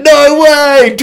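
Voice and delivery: thrilled disbelief. Retold or imitated. Boys offered the rifle, a high thrilled shout. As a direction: yelp it with glee.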